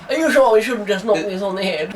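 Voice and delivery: lightly hammed-up Wolverhampton voice. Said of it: "As if someone from Wolverhampton's gonna say".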